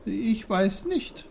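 put-on high, slow little-boy voice; small, hesitant high voice; timid young Peter. A narrator's reading. Peter answers the bear, high and unsure.

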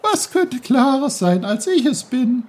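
voiced babbling brook; bubbly brook voice. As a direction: lecture Peter like a lively talking stream. Brook boasts.

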